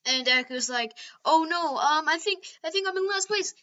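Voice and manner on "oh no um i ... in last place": high, worried, a bit louder; panicked high voice. Retold or imitated. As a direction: squeak out the worry. Deku panicking in retold scene.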